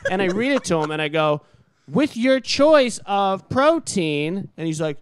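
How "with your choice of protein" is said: very slow, high and loud, word by word; exasperated reading aloud. Retold or imitated. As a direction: spell it out for a stubborn customer. Retold reading menu to customer.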